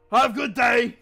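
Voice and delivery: loud, high sing-song farewell; Rock's loud chirpy goodbye; cheerful shout. Acted. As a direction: call it out brightly as he leaves. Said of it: "Rock goes".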